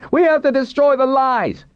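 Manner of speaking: very high, impassioned outcry; fanatical cry. A narrator's reading. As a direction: cry it out like a zealot. Captive fanatic cries out to accuser.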